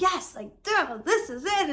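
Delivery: high, loud burst of realization; excited realization. Retold or imitated. Quoting her own thrilled realization, excitedly. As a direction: let the penny drop with a thrill.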